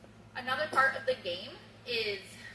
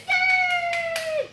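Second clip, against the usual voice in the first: long, loud, very high squeal; piercingly high drawn-out whoop; excited cheer; 10 dB louder than usual. Shown as a demonstration. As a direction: cheer wildly to rile up the dog. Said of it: "got a little more excited".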